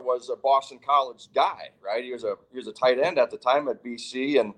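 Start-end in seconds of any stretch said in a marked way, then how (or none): none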